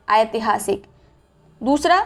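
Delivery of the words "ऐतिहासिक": ऐतिहासिक opened as "a-e", two-part vowel; AI sound. Shown as a demonstration. Teacher showing the AI vowel, exaggerated glide. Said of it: "ये है आय".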